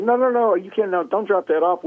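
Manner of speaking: high, hurried no-no-no from the processor; frantic protest. Retold or imitated. Processor refusing the uncleaned deer, high and frantic. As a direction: stop him before he drops the deer off. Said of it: "and he said".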